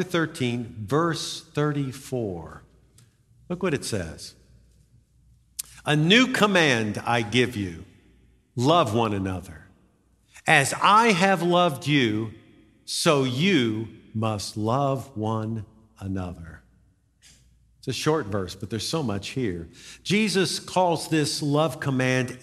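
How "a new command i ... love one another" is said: solemn Bible reading, unhurried; scripture reading. Acted. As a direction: read the verse with weight, letting it land. Reads scripture passage aloud.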